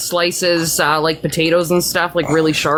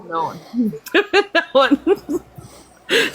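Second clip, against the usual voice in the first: laughing; 6 semitones higher, much slower than usual. Real, not acted. Laughing after a dirty joke.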